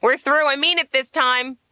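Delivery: mock furious parting shout; retold scream. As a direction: yell after a departing car, hammed-up. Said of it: "I was screaming after it".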